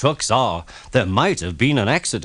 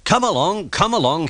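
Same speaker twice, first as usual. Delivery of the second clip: puffing; 8 semitones higher than usual. Acted. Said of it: "he puffed".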